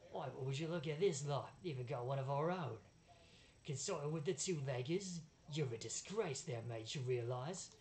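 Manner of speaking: loud, hammed-up British sneer; intentionally bad British accent. Barker taunts the rival exiles, bad British accent. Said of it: "I relish my intentionally bad British accent".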